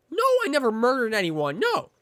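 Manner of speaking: very high, indignant denial; flustered boyfriend. Pretend. Boyfriend protests to movie dad, high flustered denial. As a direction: squeak out a mock protest.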